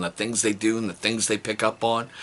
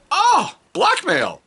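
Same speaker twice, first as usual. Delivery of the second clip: high, loud, slow oh blackmail; high slow mock outrage; mock shock; 5 dB louder, much slower than usual. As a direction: gasp it out theatrically.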